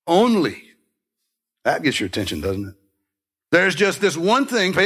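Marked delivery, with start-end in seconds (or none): 0.1-0.7 ONLY said higher and louder, leaned on; emphatic Only. Shown as a demonstration. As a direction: punch the word Only hard. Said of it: "but with more emphasis".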